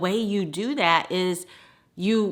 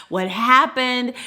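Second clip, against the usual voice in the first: panicked question; 5 semitones higher, 4 dB louder, slower than usual. Retold or imitated. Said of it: "They're panicking".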